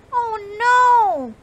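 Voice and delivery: high, loud panicked squeak. Acted. Sam squeaks in alarm as the snowball speeds downhill. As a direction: squeak out in alarm like a small mouse. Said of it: "squeaked Sam".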